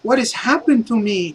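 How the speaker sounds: put-on a boy waking up lost; lightly voiced dazed kid. Ricardo's son wakes and asks the heroes, lightly voiced dazed boy. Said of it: "He's like".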